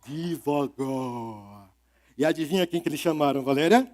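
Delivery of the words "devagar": drawn-out slow devagar; slow and low, acting out slowness. Shown as a demonstration. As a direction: stretch devagar out slowly. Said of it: "animal que fizesse tudo muito".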